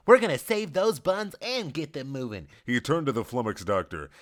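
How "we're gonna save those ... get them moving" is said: upbeat high man voicing; bright cheerleader energy; cheerful man's voice. Richard repeats, cheering on.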